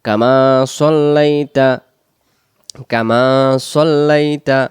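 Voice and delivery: সল্লাইতা with a heavy স, slow and loud; slow emphatic sad. Shown as a demonstration. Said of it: "পরের শব্দ". (সদ দিয়ে সল্লাইতা)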